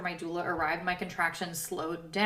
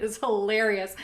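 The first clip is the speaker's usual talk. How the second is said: usual speaking voice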